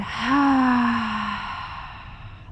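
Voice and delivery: long, audible open-mouth "haaa" sigh; ha exhale. Shown as a demonstration. Yoga teacher demonstrates exhaling through the mouth, long ha sigh. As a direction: let all the breath out on a drawn-out "ha". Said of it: "ha sound".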